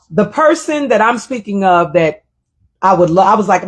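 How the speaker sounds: ordinary delivery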